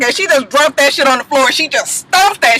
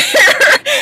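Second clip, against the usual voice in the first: squealing, near-crying laugh; a high, breathless burst of laughter; high shrieking laugh; 13 semitones higher than usual. Real, not acted. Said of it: "comedic genius".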